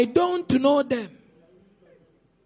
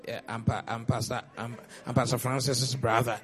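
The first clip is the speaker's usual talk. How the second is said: much lower, softer put-on voice of a boastful man; mock bragging relative; 10 semitones lower, 6 dB quieter than usual. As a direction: play a smug man name-dropping his brother. Mimicking someone boasting of connections.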